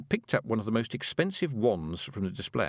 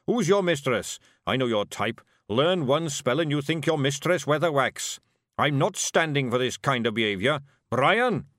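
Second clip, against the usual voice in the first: loud, indignant dwarf building to a call; scold, then holler for your helper; angry rant; 4 semitones higher, 5 dB louder than usual. Acted. Angry dwarf rants, then calls for Brian.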